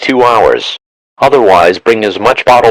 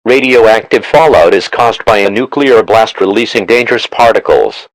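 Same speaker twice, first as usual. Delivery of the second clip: normal talking voice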